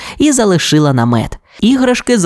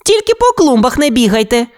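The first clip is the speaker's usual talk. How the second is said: high-pitched playful warning; crying out; 11 semitones higher, faster than usual. A narrator's reading. Toy warns friends off the flowerbeds, high cry. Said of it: "скрикнула вона".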